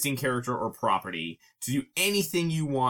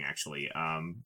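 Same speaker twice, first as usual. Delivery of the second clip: ordinary talk, nothing marked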